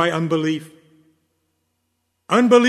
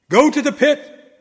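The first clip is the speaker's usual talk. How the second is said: higher, louder cry of command; 8 semitones higher, 4 dB louder than usual. Retold or imitated. Disciples crying out at the spirit, high loud command. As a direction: fling the demon to the pit. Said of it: "they cried".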